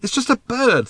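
very high, exasperated dismissal; impatient. A narrator's reading. Arthur answering Ford's worry about the bird, impatient. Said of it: "said Arthur impatiently".

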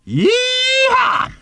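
high, drawn-out whoop; loud cowboy-style yell of joy; exhilarated shout. A narrator's reading. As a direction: whoop with wild abandon. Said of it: "shouted Ford as they shot upwards".

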